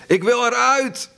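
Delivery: shrill strained shriek, voice changed; trapped man screaming in a broken, changed voice; raw scream again. A narrator's reading. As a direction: tear it out of the chest in panic. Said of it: "En opnieuw klonk het".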